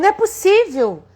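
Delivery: high, loud cry of disbelief; shocked disbelief. Retold or imitated. Clerk stunned the money is gone, high startled cry. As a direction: blurt it out, stunned.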